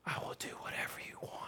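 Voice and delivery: put-on breathy girl's whisper in his ear. Girl whispering an offer to an awkward boy, hushed. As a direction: lean in and whisper it secretly. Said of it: "whispered in my ear".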